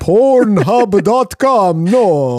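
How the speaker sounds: high, slow mock papal voice; Pope voice. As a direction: do a hammy Pope impression. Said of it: "That's my Pope voice".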